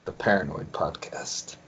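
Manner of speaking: hushed joking aside; whispered aside. Real, not acted. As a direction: whisper the joke title.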